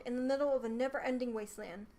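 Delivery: ordinary delivery, nothing marked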